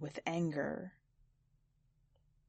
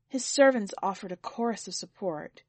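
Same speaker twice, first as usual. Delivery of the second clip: ordinary delivery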